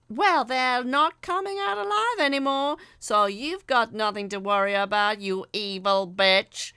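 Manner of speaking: loud furious woman shouting back; one woman screaming at another in the argument; angry shout. A narrator's reading. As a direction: yell it in a bitter row. Women shouting at each other.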